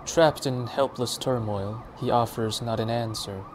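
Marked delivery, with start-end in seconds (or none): none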